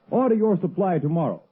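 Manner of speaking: ordinary delivery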